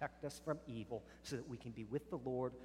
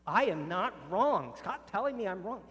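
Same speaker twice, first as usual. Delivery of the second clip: raised, indignant voice, getting louder; heated protest; 6 semitones higher, 9 dB louder than usual. Acted. Spouse snapping back in a growing quarrel, loud and indignant. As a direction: push back hard, clearly offended. Acted couple argument escalating.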